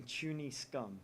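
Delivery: normal talking voice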